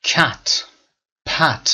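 cat and pat with a clear open æ; short A sound. Shown as a demonstration. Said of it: "صدای ا".

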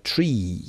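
"تری" with the final "ee" stretched out; long E. Shown as a demonstration. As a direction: draw out the vowel at the end of "تری". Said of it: "صدای ای کشیده گرفته". («تری» با ای کشیده)

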